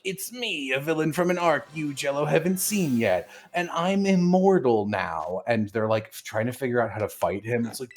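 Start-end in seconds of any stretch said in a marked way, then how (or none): 0.0-5.4 villain voice. Retold or imitated. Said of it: "and he's like".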